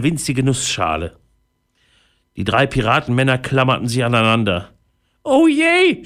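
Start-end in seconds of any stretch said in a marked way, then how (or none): 5.2-6.0 high, acted groan of woe; groaned wail. Frightened pirate clinging on in the storm, groaning. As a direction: moan it out high and drawn. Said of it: "stöhnte Einauge".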